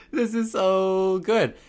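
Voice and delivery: real laughter breaking through; laughing at voice crack. Real, not acted. Vlogger laughing at his own voice crack to viewers. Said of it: "Another voice crack".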